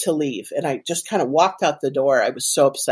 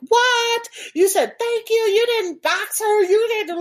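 very high-pitched mock disbelief; incredulous young people. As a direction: squeal the questions like scandalized kids. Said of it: "they're always like".

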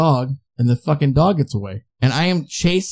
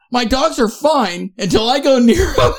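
laughing through a quote; 8 semitones higher than usual. Retold or imitated. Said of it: "He's like".

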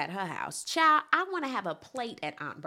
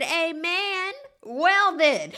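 sassy agreement; 8 semitones higher, 7 dB louder, much slower than usual. Retold or imitated. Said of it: "I said".

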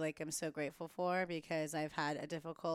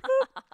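high, loud little boop; playful "boop" noise; 16 dB louder than usual. Retold or imitated. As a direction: pop out a cartoon slap sound, much higher. Imitated noise of God's slap.

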